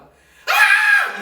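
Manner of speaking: high piercing shriek of fright; sudden high scream on waking to a snake; girly scream. Retold or imitated. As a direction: let out a shrill startled scream. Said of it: "진짜 기집애처럼".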